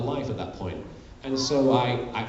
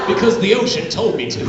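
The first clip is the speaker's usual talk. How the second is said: laughing, higher, louder manic villain; Meiko Tsunami; 8 semitones higher, 9 dB louder than usual. Retold or imitated. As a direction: cackle through a cheery murder threat. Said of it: "Meiko Tsunami's like".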